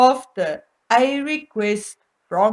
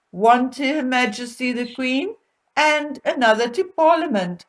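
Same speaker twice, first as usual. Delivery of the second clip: ordinary delivery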